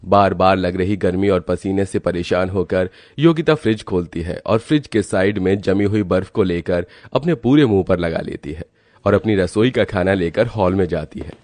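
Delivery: ordinary delivery, nothing marked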